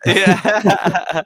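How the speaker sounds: a real laugh through the word; laughing yeah. Real, not acted. Host laughing along at the guest's accent joke, laughing yeah.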